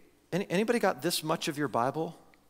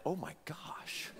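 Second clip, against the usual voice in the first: shocked whisper; 16 dB quieter, slower than usual. Retold or imitated. Courtroom reacts to insult.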